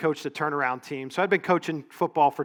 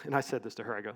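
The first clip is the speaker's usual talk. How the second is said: ordinary delivery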